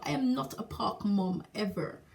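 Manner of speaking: ordinary talk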